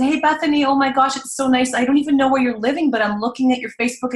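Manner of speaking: high-pitched, quick friendly gush; gushing voice message. Retold or imitated. Leaving an old Facebook friend a voice message, gushing. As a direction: gush brightly to a long-lost friend. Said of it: "I just left them a voice message".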